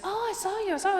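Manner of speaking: high mock fangirl voice; excited nail lady. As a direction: squeal it like a starstruck fan. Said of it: "she goes".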